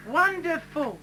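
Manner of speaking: lightly voiced eager schoolgirl; girl's voice. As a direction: pipe it up like a keen pupil. Kathy voiced in joke.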